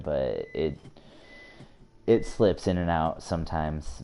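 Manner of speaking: ordinary talk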